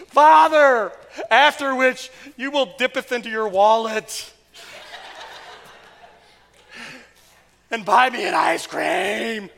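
hammed-up old-fashioned begging, loud and slow; mock pleading child. A child begs Father for the beach in old-fashioned words, hammed-up loud pleading. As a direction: plead like a child reciting scripture, overdone.

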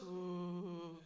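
low, soft ghost moan; a long, hushed mock-spooky ooh; ghostly "ooh". Preacher imitating Jesus coming like a ghost, spooky moan. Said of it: "coming like a ghost".

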